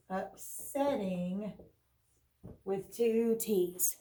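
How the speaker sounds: "upsetting" said slowly and clearly; careful spelling-out. Shown as a demonstration. Teacher slowly spelling out "upsetting" with two T's. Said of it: "giving us the word".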